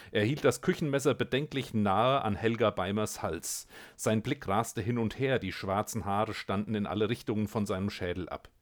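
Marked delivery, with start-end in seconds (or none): none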